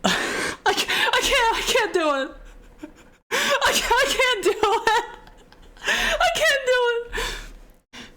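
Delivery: laugh-sob through the words, unable to go on; helpless laughter. Real, not acted. Streamer cracks up reading fan fiction, helpless laughter.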